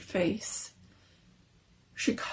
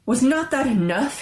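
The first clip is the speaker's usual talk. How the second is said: higher and louder, fierce; fierce demand; 6 semitones higher, 6 dB louder than usual. A narrator's reading. Husband confronting his wife about the wish, fierce. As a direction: challenge her hard. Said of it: "he demanded fiercely".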